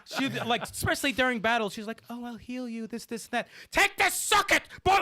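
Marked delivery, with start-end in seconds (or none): 2.1-3.5 a lightly voiced sugary healer, higher; mock-sweet, offering help nicely. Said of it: "the sweetest, nicest person". 3.7-5.0 high, loud mock battle yell; yelling healer. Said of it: "she's just yelling and shit".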